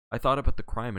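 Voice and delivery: ordinary delivery, nothing marked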